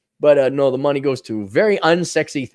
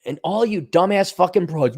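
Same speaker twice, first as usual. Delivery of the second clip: normal talking voice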